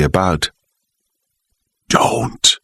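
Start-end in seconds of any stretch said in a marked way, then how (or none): 1.9-2.6 whispered warning; quietly. A narrator's reading. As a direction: breathe it out, barely voiced. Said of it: "she said quietly".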